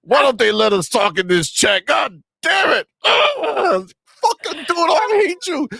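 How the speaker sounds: hammed-up sobbing fanboy fury; mock ponies whining. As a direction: throw a fake crying tantrum. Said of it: "That's what they're saying right now".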